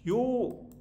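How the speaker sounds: 요 lifted high as a sentence ending. Shown as a demonstration. Teacher contrasts dialect endings, high lifted 요. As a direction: say 요 with a high lift. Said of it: "지는 요로 발음하겠죠".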